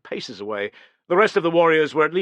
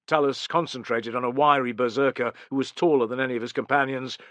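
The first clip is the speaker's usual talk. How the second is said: ordinary delivery, nothing marked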